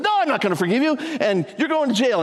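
high, acted-out refusal; mock-indignant no; retold harsh refusal. Retold servant refuses the debtor.

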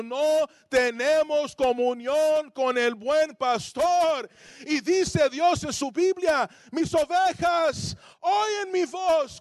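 slow, drawn-out preaching, rising in pitch; impassioned preaching. Real, not acted. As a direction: build to a high, ringing declaration. Preacher building to sermon climax.

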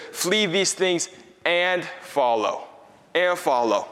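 "Flee these things and follow" read very slowly, FLEE stressed; slow emphatic reading. Shown as a demonstration. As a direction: linger on each word, leaning on "Flee". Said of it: "it says".

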